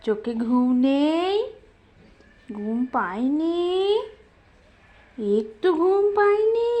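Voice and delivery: slow, lilting coo. Real, not acted. Parent teasing baby about not sleeping, drawn-out baby talk.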